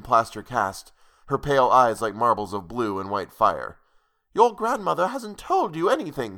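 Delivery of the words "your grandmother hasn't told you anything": higher, hurried mild rush; hastily. A narrator's reading. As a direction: blurt it out a little hastily. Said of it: "she said hastily".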